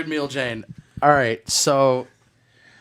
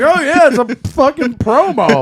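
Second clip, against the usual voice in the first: higher and louder, laughing at the host; teasing the host for turning a story into an ad, laughing; mocking through laughter; 10 semitones higher, 6 dB louder than usual. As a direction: imitate him gleefully, cracking up. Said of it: "you're just fucking over here like".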